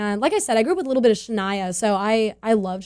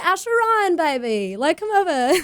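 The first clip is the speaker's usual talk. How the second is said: put-on Australian accent; 6 semitones higher than usual. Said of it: "My terrible accent".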